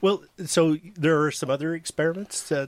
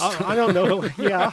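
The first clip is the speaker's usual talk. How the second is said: laughing; 6 semitones higher than usual. Real, not acted. Eating sorbet, laughing.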